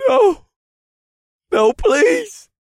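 very high, loud wails of refusal; terrified begging. Acted. Warlock told to pick his death, terrified. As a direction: shriek the plea in panic. Warlock begs not to die.

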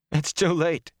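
high-pitched overwrought outburst. A narrator's reading. Rand to friends arriving after the fire, overwrought. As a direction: tell them, voice pitched high.

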